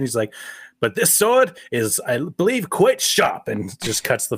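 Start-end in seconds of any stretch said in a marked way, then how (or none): 0.8-3.5 high, slow theatrical film-character retort; hammed-up duelist, drawn out and pitched up; mock swordsman. Acting out the film's sword retort.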